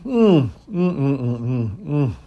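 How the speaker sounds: appreciative mm of tasting; savouring hums. Real, not acted. Priest savouring his pipe on camera, pleased hums. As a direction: hum with pleasure over the smoke. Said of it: "Golly, that's good".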